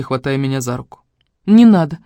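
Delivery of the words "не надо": loud, higher hurt protest; upset. A narrator's reading. Sister jerking her arm away, upset. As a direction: pull away with an upset cry. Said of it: "Огорчённо всхлипнула я".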